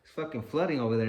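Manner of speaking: loud, concerned relatives; worried calling. Retold or imitated. Relatives calling, worried about flood.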